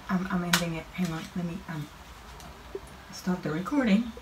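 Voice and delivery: soft, shaken mumble; quiet. Real, not acted. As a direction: trail off quietly. Shaken, winding down.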